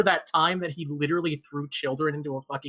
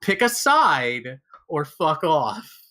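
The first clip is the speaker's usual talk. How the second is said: each word slow and louder, punched out; slow emphatic demand; 4 dB louder, much slower than usual. Real, not acted. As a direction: spell out the ultimatum word by word. Ranting at co-host over Estinian.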